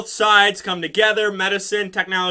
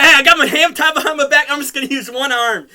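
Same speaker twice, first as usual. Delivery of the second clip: cocky boast; 7 semitones higher, 6 dB louder, faster than usual. Retold or imitated. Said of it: "it's like".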